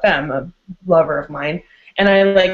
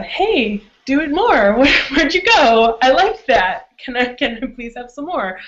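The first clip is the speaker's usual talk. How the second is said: higher, playful begging voice; imitated eager pleading; 5 semitones higher than usual. Bottom asks the top for more, imitated eager pleading.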